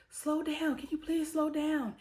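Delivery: high, pleading mock panic; scared kids in the back seat, voiced high; imitating panicked girls. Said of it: "the girls are basically like".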